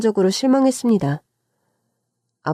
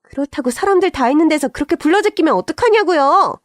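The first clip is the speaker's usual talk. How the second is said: high, heated protest; angry, voice climbing to the end; raised angry voice; 6 semitones higher than usual. A narrator's reading. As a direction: escalate into an indignant outburst. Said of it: "동서의 언성이 높아지니까".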